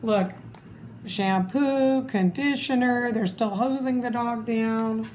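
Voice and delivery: ordinary delivery, nothing marked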